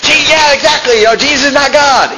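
high, eager mock-excited agreement; all excited. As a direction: ham up the Jehovah's Witness jumping at it. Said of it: "He was all excited".

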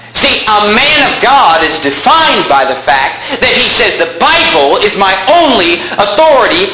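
high-pitched, emphatic pulpit delivery; impassioned preaching. Real, not acted. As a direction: preach it hard, pitch climbing with conviction. Preaches at full force.

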